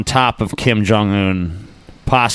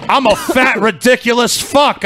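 mock shout; 11 semitones higher, slower than usual. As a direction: yell it like a hammy confession. Host voices the man confessing.

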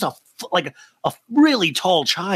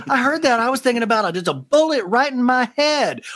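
high, fast dramatic voice; mock dramatic Shep; 7 semitones higher, faster than usual. Shep telling Taylor his dark thoughts, high dramatic. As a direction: rush it melodramatically. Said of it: "He was like".

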